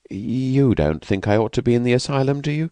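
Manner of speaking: lightly voiced timid woman, anxious; frightened young woman. Escaped woman asks the narrator, lightly voiced and anxious.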